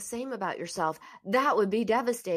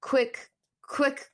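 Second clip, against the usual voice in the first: normal talking voice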